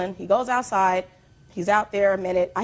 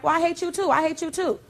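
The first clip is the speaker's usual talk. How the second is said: high-pitched quarrel comeback, not shouted; retold angry retort; 9 semitones higher than usual. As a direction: throw it back at them, acting out the row. Retold quarrel, her answer back.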